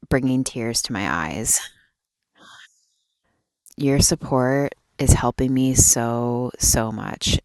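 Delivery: ordinary delivery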